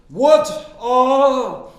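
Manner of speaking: high, slow, booming professor impression; loud, drawn-out imperious law professor; Professor Kingsfield voice. Imitating the professor as he booms a question at the silent class. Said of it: "he really did talk like Professor Kingsfield".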